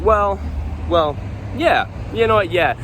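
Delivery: mildly hammed-up hesitation before owning up; hedging admission. Server answering customers about speaking Japanese, mock hedging.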